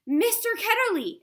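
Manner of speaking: high, loud sudden shout. Acted. Girl suddenly remembering the name, full shout. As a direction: burst out with the name as it comes back. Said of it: "she shouted out".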